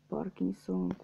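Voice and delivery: ordinary talk, nothing marked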